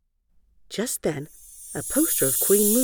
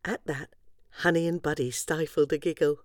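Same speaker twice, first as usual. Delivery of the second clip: ordinary delivery, nothing marked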